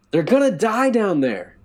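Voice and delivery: loud, high desperate shout. A narrator's reading. Narrator shouting at the guard, desperate. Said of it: "I shouted".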